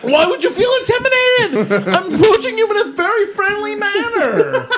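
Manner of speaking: high, incredulous comic yelling; worked-up shout. Real, not acted. Host insists to co-host he is friendly, shouting. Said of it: "I have never seen [name] like this".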